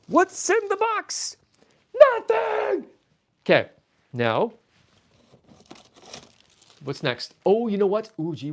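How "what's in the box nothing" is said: much higher, slow squeak then a shouted answer; high squeaky comic voice shouting the punchline; silly cartoon voice. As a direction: play a goofy cartoon character.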